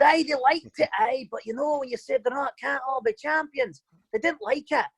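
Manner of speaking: high-pitched imitation of a Scottish coach; quoting the coach in a Scottish accent. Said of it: "I'll do the accent".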